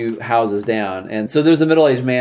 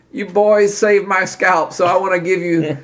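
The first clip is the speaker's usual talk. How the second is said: grateful Rebus; 9 semitones higher, 4 dB louder than usual. Acted. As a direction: voice a grateful character, pitched up and emphatic.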